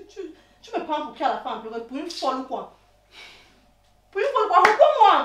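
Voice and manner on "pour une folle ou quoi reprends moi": high, loud angry challenge; jealous outburst at raised pitch; furious shout. Acted. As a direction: explode at him, demanding an answer. Repeats the question, blowing up.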